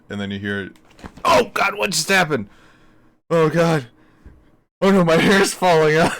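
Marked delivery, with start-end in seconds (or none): 1.2-6.1 mock panic. Mock panicked victim of transport.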